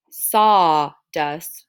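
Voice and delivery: SAW-DUST split into two stretched syllables; no L after aw. Shown as a demonstration. Teacher checking for an L, syllables drawn apart. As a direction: hold an open aw in "Sawdust", then dust apart. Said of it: "sounds like there's an L".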